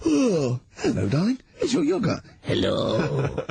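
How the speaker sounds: high, breathy flirty yogurt voice; femme fatale. Retold or imitated. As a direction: coo it like a seductive talking yogurt. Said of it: "he does all as a little scenario".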